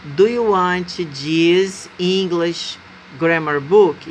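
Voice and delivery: slow, deliberate read of the full sentence; slow demo. Shown as a demonstration. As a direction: spell out the repeated version slowly. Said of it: "pra não repetir". (leitura lenta e marcada, sem usar "one")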